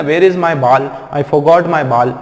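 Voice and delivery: "ball" said as "baal" both times; mistaken aa vowel. Shown as a demonstration. Teacher repeating the common speaking mistake, long aa in ball. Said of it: "जो गड़बड़ करते हैं ना बोलने में".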